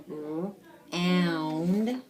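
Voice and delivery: "and" said as its own separate chunk; sounded-out word part. Shown as a demonstration. Teacher showing a pupil a word part, sounded out. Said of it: "So we know this part is".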